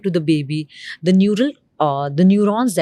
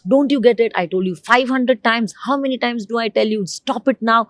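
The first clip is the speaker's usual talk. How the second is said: higher, mock scolding mother with a fast, exasperated middle; 4 semitones higher, faster than usual. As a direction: play the fed-up mom nagging her child, pitched up. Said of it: "if mama is scolding the child".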